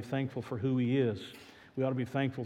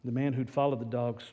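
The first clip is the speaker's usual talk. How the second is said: ordinary talk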